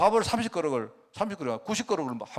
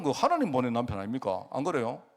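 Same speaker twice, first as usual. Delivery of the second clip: normal talking voice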